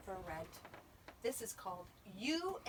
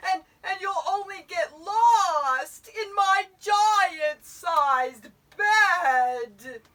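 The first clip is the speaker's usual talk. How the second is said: loud, slow, drawn-out wail; bawling bear; 9 semitones higher, 19 dB louder, much slower than usual. A narrator's reading. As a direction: sob out the complaint hugely. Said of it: "could hear the voice bawl".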